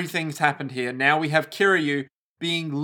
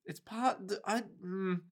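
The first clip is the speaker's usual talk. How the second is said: soft, halting mumble; stammering Dane; 4 semitones higher, 13 dB quieter than usual. Acted. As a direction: trail off softly, lost for words. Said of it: "Anyone from Denmark is just gonna be like".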